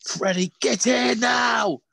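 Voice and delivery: high, drawn-out parental shout; hammed-up furious summons; mock angry call. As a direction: call the kids in like they're in big trouble. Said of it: "call 'em like they're in the shit".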